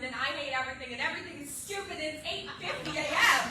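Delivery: rising to a loud, high outburst; building rant. Acted. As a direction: build the list into a near shout.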